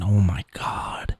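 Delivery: slow, breathy whisper of disbelief; whispered oh my god. Real, not acted. Host reacting to the stream clip, whispered dismay. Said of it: "Ho-, I mean".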